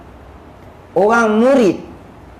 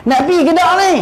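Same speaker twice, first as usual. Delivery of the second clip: higher-pitched, exasperated nagging; impatient scolding; 9 semitones higher, much faster than usual. Acted. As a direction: hurry him up, fed up. Scolding a dawdling companion. (menegur dengan tidak sabar, nada tinggi)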